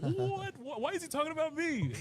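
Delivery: high, squeaky astonished question; shocked disbelief. Retold or imitated. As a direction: gasp it out in total disbelief, pitch way up. Hearing [name] mentioned her.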